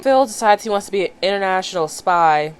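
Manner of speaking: ordinary talk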